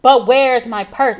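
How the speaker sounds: loud, slow insistent question; demanding. Retold or imitated. Paranoid patient to the nurses, demanding. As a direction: demand it like nothing else matters. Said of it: "she's like".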